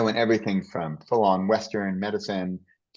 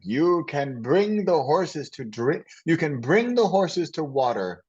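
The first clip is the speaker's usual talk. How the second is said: put-on slow Italian-accented professor voice, higher and drawn out; Italian guy impression; 7 semitones higher, slower than usual. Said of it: "he was, like, this Italian guy".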